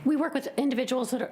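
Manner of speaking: strained high voice, rushed; voice gone. Real, not acted. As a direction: voice giving out mid-sentence. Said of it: "my voice has gone".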